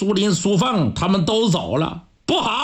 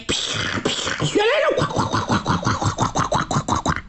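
imitated galloping hooves; faster than usual. Horses gallop off.

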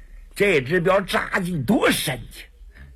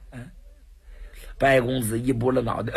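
ordinary delivery

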